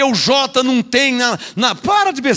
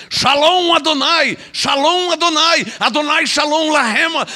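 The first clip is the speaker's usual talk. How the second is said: higher, slow mock-pious chanting; mock Hebrew-only believer; 3 semitones higher than usual. Preacher mocking believers who speak only Hebrew, mock-pious. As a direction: parody someone showing off Hebrew phrases. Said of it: "Tem uns que falam só em hebraico".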